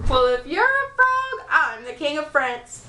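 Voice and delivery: mock him like a sarcastic witch; scoffing witch. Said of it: "said the witch".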